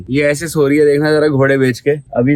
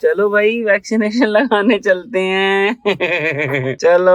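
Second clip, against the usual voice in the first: higher, slow coaxing voice; 8 semitones higher, slower than usual. Real, not acted. Father coaxes baby to vaccination, higher and slow.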